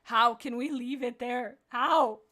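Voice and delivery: loud, slow exclamation of disbelief; shocked dismay. Real, not acted. Reader stunned by the story's ending, loud dismay. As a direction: protest loudly, punching the last "How". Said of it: "I'm in shock, awe, and dismay".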